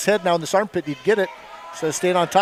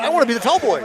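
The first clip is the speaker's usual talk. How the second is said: laughing while quoting; 6 semitones higher, 3 dB louder, much faster than usual. Retold or imitated. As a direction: quote the kid through laughter, a bit higher. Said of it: "He's going".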